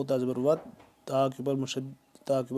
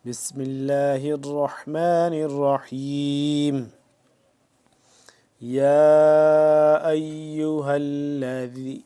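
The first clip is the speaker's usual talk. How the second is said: slow, drawn-out chanted tilawat; Quran recitation; 5 dB louder, much slower than usual. Shown as a demonstration. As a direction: recite melodically, stretching every vowel. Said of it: "آج کے سبق کی طرف چلتے ہیں".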